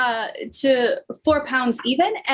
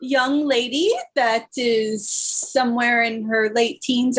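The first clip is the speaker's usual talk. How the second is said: normal talking voice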